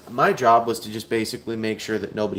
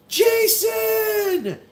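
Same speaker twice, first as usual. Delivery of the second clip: excited shout. Retold or imitated. Boss greeting Jason David Frank with a big excited shout. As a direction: call out across the shop, thrilled to see him.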